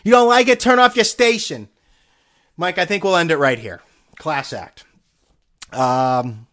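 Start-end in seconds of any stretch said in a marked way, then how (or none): 0.0-1.7 loud, high retort; imitated shout. Anchor snapping at caller, loud and high. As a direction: yell it like an angry sports anchor. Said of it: "Russ Salzberger when he's talking to him".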